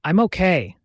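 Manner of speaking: usual speaking voice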